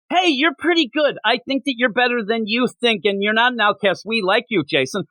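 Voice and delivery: cheesy pep talk. Retold or imitated. Acted Robin pep talk, high.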